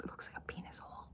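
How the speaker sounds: ordinary delivery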